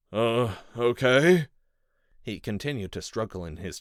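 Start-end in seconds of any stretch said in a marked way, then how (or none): 0.1-1.5 uneasy captive man. A narrator's reading.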